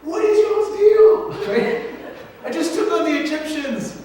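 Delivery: high, loud, incredulous mock rant; hammed-up divine exasperation; exasperated God. God to the scared Israelites, high mock exasperation. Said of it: "he basically says".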